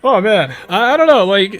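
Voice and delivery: loud, high-pitched sarcasm; overdone mock dismay; sarcastic. Real, not acted. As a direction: exaggerate the helplessness, loud and high. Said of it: "in a sort of sarcastic way".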